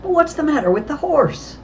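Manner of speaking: very high, agitated question; breathless. A narrator's reading. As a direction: ask urgently, as if just shaken. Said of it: "Nancy replied breathlessly".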